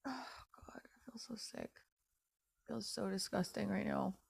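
very soft, drained confession; sickened near-whisper. Real, not acted. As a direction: barely voice it, sick with self-disgust. Sick with disgust at herself.

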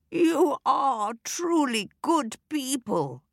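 put-on high, quavering old lady; wobbly frail old woman voice. A narrator's reading. Fairy disguised as old lady, quavering and high.

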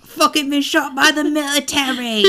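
a playful mock yell; mock dramatic outcry. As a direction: overplay the shock for a laugh. Joking melodramatic quote with laughter.

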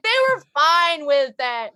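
high, slow clueless American; mock ignorant American; idiot American. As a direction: drawl it like a dumb tourist. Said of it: "sounds like the idiot American that's like".

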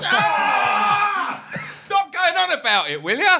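fed up, yell at him to drop it; exasperated outburst. Real, not acted. Exasperated at Almunia being raised again.

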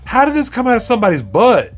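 high, astonished disbelief; high incredulous outcry; retold disbelief. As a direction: act out shock at the huge turd. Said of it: "I'm like".

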